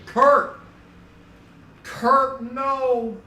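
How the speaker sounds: high, loud wail of protest; anguished outcry. Acted. Streamer mourning Kurt's bad luck, anguished high shout. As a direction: cry out as if watching a tragedy.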